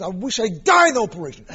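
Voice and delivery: sudden loud, fast outburst; bursting out. Retold or imitated. Michael bursting out at his wife, loud and fast. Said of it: "he bursts out and says".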